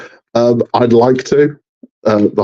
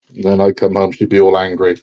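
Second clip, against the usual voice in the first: ordinary talk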